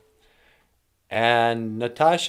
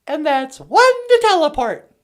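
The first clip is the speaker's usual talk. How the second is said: very high, squeaky machine voice; squeaky computer voice; 7 dB louder than usual. Acted. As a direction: play the teleporter as a chirpy gadget. Teleporter voice replies.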